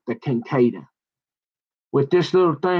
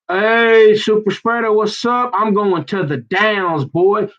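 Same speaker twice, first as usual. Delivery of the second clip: hollered taunt; 5 semitones higher, slower than usual. Acted. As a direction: call out cockily, stretching the last words. Hollered greeting to an imagined target.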